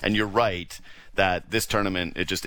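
ordinary talk, nothing marked

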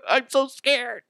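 high-pitched scared voice; mock fear. Husband after the bed incident, mock fear. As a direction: play terrified in a high, hammed-up voice.

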